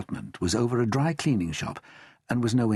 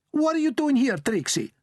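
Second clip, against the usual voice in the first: high, loud, sharp demand; stern nonna challenge; 3 dB louder than usual. A narrator's reading. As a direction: confront the unwelcome guest. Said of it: "she said sharply".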